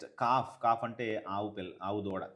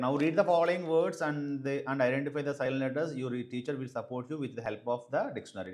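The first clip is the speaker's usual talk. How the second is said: higher, louder, slow reading of the instructions; textbook read-aloud; 3 semitones higher, 5 dB louder, much slower than usual. Acted. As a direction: read the exercise out to the class. (గట్టిగా నెమ్మదిగా సూచనలు చదవడం)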